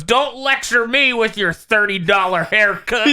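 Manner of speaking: hammed-up Southern retort; Southern trucker accent. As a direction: snap back as a Southern trucker. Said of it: "And then he goes".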